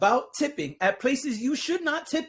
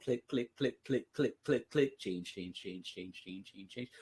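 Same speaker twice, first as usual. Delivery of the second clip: soft low click sounds; quiet mimicking clicks; 11 semitones lower, 13 dB quieter than usual. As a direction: murmur the clicks under your breath.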